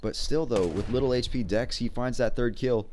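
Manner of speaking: excited play-by-play. Acted. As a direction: hype up the clutch plays. Play-by-play of a clutch round.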